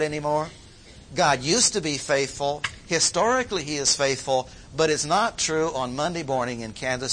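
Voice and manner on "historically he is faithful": normal talking voice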